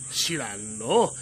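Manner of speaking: low, slow, dry brush-off; dismissive. Acted. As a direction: shrug it off unimpressed. 和尚がそっけなく返す. (低くゆっくりそっけなく)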